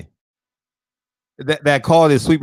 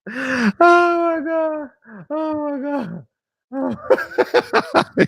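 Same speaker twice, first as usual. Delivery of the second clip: high, slow laughing wail; helpless laughter; 10 semitones higher, much slower than usual. Real, not acted. Streamer losing it at the chat's comments, high laughing wail.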